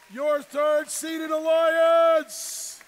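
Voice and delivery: very slow, stretched arena call; announcer shout. Real, not acted. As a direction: draw out every word for the crowd. Announcing alliance to crowd.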